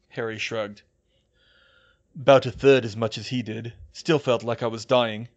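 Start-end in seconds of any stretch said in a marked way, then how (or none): 2.1-5.3 lightly voiced, loud weary reply; a hint of a spent character; Harry's voice. A narrator's reading. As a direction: answer with effort. Harry answers, voiced by narrator.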